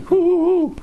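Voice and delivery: high, loud mocking hoo hoo hoo; mock hoot. Teacher to his audience, mocking the idea with a high loud hoot. Mocks happy realization.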